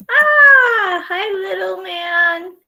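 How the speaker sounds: high sing-song voice for the dog. Real, not acted. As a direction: coo at the little dog. Greeting the dog.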